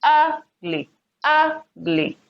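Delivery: "Ugly" with UG pitched high, "ly" dropped; stress on first syllable. Shown as a demonstration. Teacher confirming the answer, "Ugly" stressed on the first syllable. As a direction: lean high on the UG of "Ugly", then pause before "ly". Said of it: "the first syllable".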